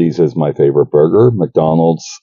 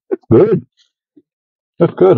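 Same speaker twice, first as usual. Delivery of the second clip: excited; 13 semitones higher than usual. Real, not acted. First pickle bite surprise.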